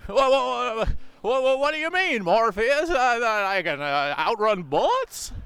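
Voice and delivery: Jimmy Stewart impression. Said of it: "Jimmy Stewart in The Matrix".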